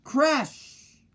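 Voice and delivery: high, falling crash!; crash! called high and falling; crash sound. A narrator's reading. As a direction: belt out the crash like a sound effect. Sound effect word in story.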